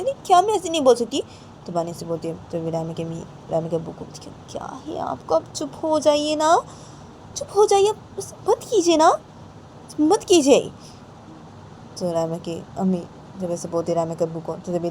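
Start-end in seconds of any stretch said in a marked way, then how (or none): 0.0-1.2 put-on much higher, louder child protest; little girl defiantly answering her father; indignant protest. 4.5-10.6 much higher, louder wife scolding; shrill scolding. Acted. Wife shouting at husband.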